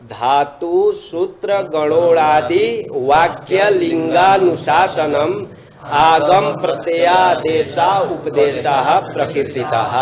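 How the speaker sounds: very slow chanted Sanskrit verse; verse recitation. Acted. Teacher quoting the verse other scholars cite, slow chant. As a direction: draw out each word as a sacred verse.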